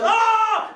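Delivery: high loud startled yelp; short high yell of falling; mock cry. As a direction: yelp as she falls. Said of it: "And he goes".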